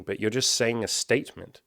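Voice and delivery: normal talking voice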